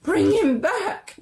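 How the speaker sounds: high, slow, pleading cry; wife begging him, pitched high and drawn out. A narrator's reading. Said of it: "cried the old woman".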